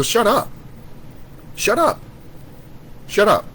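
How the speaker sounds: high-pitched, repeated cut-off; exasperated silencing. Real, not acted. Silencing imagined objectors.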